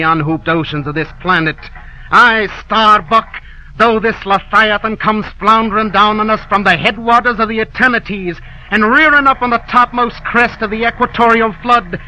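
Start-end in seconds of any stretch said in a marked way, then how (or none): 2.1-12.0 fierce vow. Acted. Ahab vows to kill whale.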